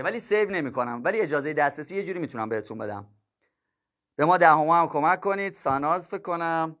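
normal talking voice

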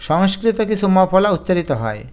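ordinary talk, nothing marked

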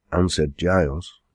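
ordinary delivery, nothing marked

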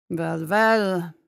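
gruff, amused voice from the shadows. Acted. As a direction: drawl it out rough and knowing. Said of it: "said a gruff voice from the shadows".

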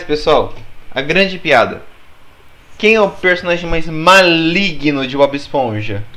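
normal talking voice

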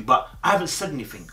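usual speaking voice

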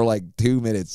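ordinary talk

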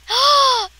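sudden high gasp of surprise; sharp gasp. Real, not acted. Kid spots a new item, gasps. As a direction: gasp at something spotted.

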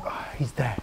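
very low, soft murmur to himself; quietly relieved, barely voiced aside; hushed relief. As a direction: drop right down under your breath. Acts out a secret relieved glimpse.

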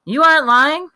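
loud, high disbelief; astonished boy. Acted. Kenny doubts Rufus's squirrel story, high and loud. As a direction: demand he swear it's true.

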